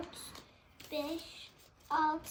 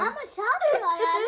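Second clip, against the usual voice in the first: being tickled; 4 semitones higher, 10 dB louder than usual. Real, not acted. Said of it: "Daha ıslatırken gülmeye başladın".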